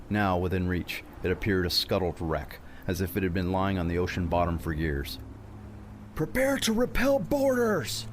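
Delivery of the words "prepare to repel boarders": high, drawn-out shouted cry, slow; cried out. Acted. Captain warns crew of boarders, slow high cry. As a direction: cry the order over rising horror. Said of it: "he cried".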